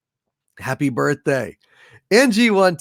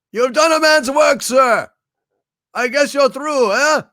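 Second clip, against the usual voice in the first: movie quote; 9 semitones higher, 4 dB louder than usual. Acted. Quoting movie lines for a viewer.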